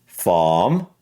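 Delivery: British "Farm" with silent R; British accent. Shown as a demonstration. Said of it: "And for British".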